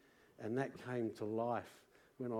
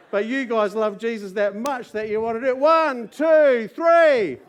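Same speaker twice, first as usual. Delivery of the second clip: loud, much higher, rousing the congregation; fiery preaching; 8 semitones higher, 12 dB louder than usual. Acted. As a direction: preach it out to the whole room. Preaching to congregation at full force.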